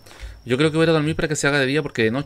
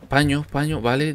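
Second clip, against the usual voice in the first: normal talking voice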